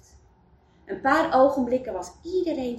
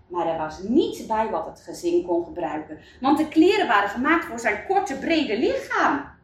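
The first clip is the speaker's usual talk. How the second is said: plain reading voice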